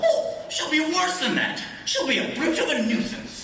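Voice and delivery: high, exclaiming complaint; emphatic dismay. Acted. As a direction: top your friend's complaint with relish. Exclaims about troublesome aunt.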